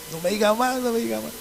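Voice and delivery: high, very soft, quick aside; hushed inner thought. Retold or imitated. Preacher silently egging on the generous brother, high hushed voice. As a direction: squeak it softly as a secret thought.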